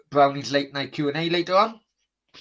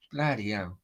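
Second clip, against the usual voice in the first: ordinary talk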